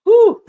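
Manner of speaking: high, loud blow of relief; relieved whew. Real, not acted. Fan exhaling relief after a dicey finish, high and loud. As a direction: let the tension out in one whew.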